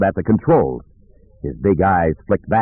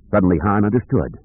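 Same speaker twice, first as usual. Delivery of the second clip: ordinary delivery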